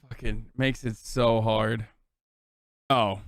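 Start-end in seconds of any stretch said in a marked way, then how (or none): none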